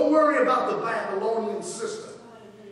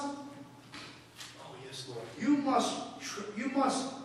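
quiet prayer aside; 7 semitones lower, 11 dB quieter than usual. Real, not acted. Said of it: "murmured prayer aside".

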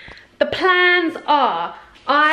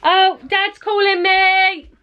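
surprised; 9 semitones higher, 5 dB louder, much slower than usual. Real, not acted. Calling out surprised.

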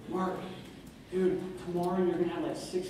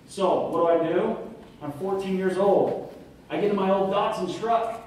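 ordinary talk, nothing marked

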